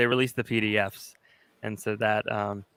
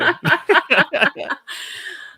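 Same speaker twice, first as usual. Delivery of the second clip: high giggling laugh; 6 dB louder than usual. Real, not acted. Host laughs at the wig.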